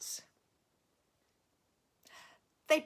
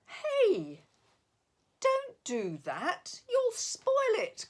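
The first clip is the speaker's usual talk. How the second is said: calling out; 14 semitones higher, 3 dB louder, slower than usual. A narrator's reading. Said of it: "called a voice".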